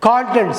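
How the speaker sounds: கான்டென்ட் as 'KAAN-tent'; correct long-vowel pronunciation. Shown as a demonstration. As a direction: lean on the long first syllable of கான்டென்ட். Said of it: "கண்டென்ட்னு சொல்லக்கூடாது". (கான்டென்ட் - 'கா' நீட்டி உச்சரிப்பு)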